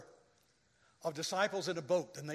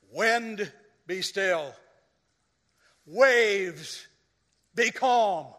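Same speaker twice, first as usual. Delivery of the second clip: commanding; 2 semitones higher, 8 dB louder, much slower than usual. Retold or imitated. Jesus commands the storm.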